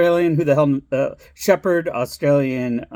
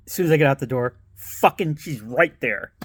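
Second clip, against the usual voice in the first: normal talking voice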